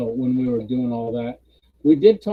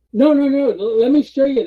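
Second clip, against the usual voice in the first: high, loud interruption; imitating an eager presenter; flustered insistence; 10 semitones higher, 8 dB louder than usual. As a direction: cut in with rapid mock protests. Presenter insists on showing map.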